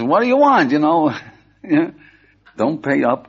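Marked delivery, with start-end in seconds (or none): 0.0-0.7 imitating a grudging, higher, louder snap; mock the annoyed workman. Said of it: "he grudgingly says".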